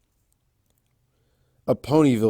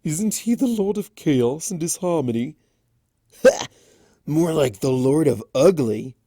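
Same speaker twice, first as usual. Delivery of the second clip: scoffing gossip voice, higher; sneering pony; 5 semitones higher, 5 dB louder than usual. Acted. Townspony sneering at Discord, mocking. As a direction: jeer at the creature's looks.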